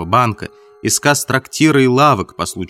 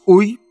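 sharp high cry of pain, louder; sudden groan; 10 semitones higher, 5 dB louder than usual. A narrator's reading. Marvel's sudden loud groan of pain. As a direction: Marvel yelps as pain strikes him. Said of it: "простонал он".

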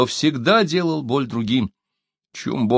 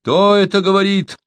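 high, anxious challenge; storyteller voicing a worried sentry; alarmed; 10 semitones higher, 4 dB louder than usual. A narrator's reading. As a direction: call out the question in alarm. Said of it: "раздался встревоженный голос".

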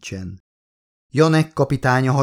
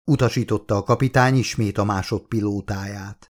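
ordinary delivery, nothing marked

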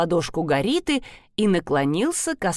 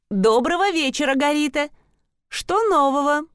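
bright greeting; 7 semitones higher than usual. Acted. Greeting the nosy neighbour brightly.

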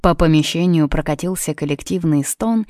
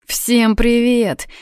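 slow, higher, drawn-out hello; exhaled greeting; 7 semitones higher, much slower than usual. A narrator's reading. Камали greets everyone after a night's drinking, exhaling it slowly. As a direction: breathe out a long greeting. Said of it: "выдохнула Камали".

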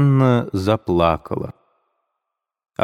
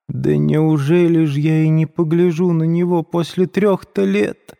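higher, plaintive tearful wail; tearful mother; 9 semitones higher than usual. A narrator's reading. Пульхерия Александровна плачет, умоляя сына, высоким плачущим голосом. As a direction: plead through tears, voice rising high. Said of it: "заплакала Пульхерия Александровна".

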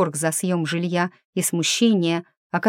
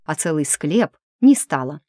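plain reading voice

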